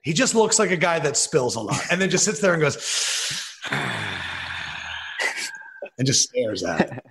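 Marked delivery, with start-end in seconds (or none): 2.8-5.6 long, soft, low exhale; deflated sigh, acting out a spill; imitated long sigh. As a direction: let out a drawn-out mock sigh. Imitates Gruden after a spill.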